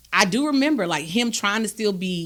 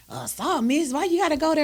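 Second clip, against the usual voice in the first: high, dismissive mock boys' voice; mimicking the boys brushing off the assault; 10 semitones higher than usual. Mock dismissive boys, higher. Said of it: "And they're like".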